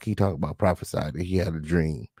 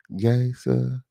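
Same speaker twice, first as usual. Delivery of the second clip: ordinary talk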